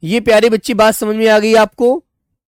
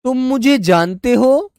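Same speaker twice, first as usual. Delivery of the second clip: surprised; 6 semitones higher, slower than usual. Shown as a demonstration. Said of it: "दूसरा वाक्य हम कहेंगे".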